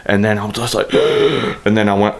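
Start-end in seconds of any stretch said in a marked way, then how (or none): 0.9-1.6 sharp voiceless gasp; imitated gasp. Retelling the moment he dropped, mock gasp. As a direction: suck in air as if winded.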